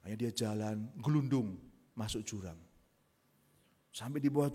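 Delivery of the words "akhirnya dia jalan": normal talking voice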